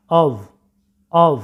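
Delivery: অব ending in voiced v, "ov"; correct pronunciation. Shown as a demonstration. Teacher corrects students' word, correct pronunciation. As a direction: model অব with a buzzing v. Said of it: "ভি দ্বারা উচ্চারণটা করা হচ্ছে".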